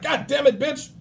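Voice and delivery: high, fast mock tirade; hammed-up, fast, high, angry rant; twitchy angry rant. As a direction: rattle it off like a jumpy hothead.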